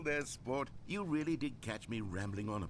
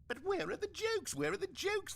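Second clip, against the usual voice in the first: much higher, whiny complaining critic; mock whining critics. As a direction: whine like a let-down fan. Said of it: "they bemoaned".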